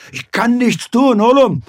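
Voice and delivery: high, loud anguished cry to his son; father shouting his helplessness at his son; desperate outburst. Acted. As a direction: burst out in helpless despair.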